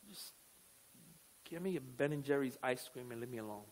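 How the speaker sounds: sigh, then low, soft grumble; mock dejected plea to be left alone; in a funk. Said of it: "In a funk where you're like".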